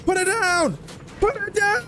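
pleading climbing towards a scream; rising panic. Real, not acted. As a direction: desperation building each time. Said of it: "that little screaming fit".

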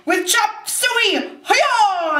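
high, slow war cry; hammed-up karate battle cry; mock martial artist. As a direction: stretch it out, then strike with a yell. Acts a martial arts attack.

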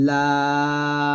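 "লাম" thin, with a very long "aa"; thin light L. Shown as a demonstration. Teacher demonstrating lam, thin and prolonged. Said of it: "লামটা পাতলা করে".

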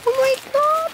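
high-pitched squeal of wonder; squeal, overcome by the sight; real excitement. Real, not acted. Seeing a beautiful pot, excited squeal. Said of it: "I'm so excited".

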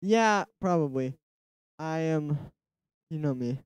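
ordinary talk, nothing marked

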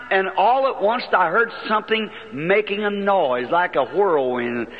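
ordinary talk

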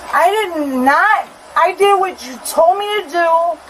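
loud, slow, furious at the officer; angry yelling. Real, not acted. Drunk woman yelling at police at her door, loud and angry. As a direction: yell it, fed up and outraged. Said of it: "you're being too loud".

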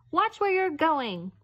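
slow, high scolding; slow high-pitched squirrel scolding. Acted. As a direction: scold him for knocking you down. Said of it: "the squirrel scolded".

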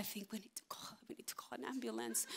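breathless whispered plea; whispering. Retold or imitated. Exhausted wife begging husband to call paramedics, whispered. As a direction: whisper it like you can barely get air. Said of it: "I was like this".

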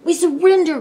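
loud, high exasperated groan; worn-down pirate dad, loudly giving up; groaned surrender. A narrator's reading. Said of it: "groaned Pa".

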